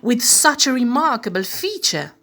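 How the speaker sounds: loud, high hearty declaration; declared. Acted. Sydney to the king, loud proud declaration. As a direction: proclaim it proudly. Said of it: "Sydney declared".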